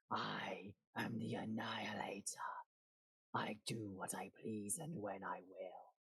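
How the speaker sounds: hushed, menacing dragon boast; arrogant dragon. Acted.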